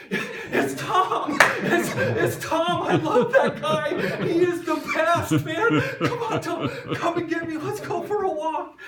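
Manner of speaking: very high, loud, laughing yelps of joy; dog greeting its favourite walker with delighted yells; overjoyed dog. Acted. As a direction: shriek like a dog thrilled to see its walker. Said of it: "he's like".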